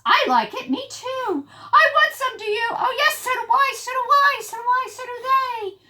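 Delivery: eager squeals. Acted. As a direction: squeal like greedy little critters. Excited prairie dogs want fuzz.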